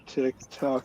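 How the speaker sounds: tick tock clicks. Pretend. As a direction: breathe tick tock quietly for ASMR. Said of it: "Is that A M S R enough?".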